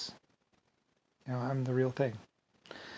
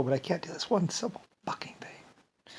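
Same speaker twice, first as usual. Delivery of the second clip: softer, bitter whisper rising at the end; frustrated whisper; 5 semitones higher, 5 dB quieter than usual. Retold or imitated. Yelling at himself over one simple thing, whispered. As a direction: spit the frustration out under your breath. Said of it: "I'd yell at myself".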